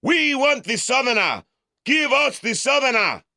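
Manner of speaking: a crowd baying for him; screaming mob. Acted. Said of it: "they screamed".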